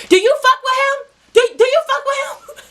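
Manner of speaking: high, loud imitation of the rival, breaking into laughter; laughing retold challenge. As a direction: mimic the girl's demand while cracking up. Rival girl confronts her.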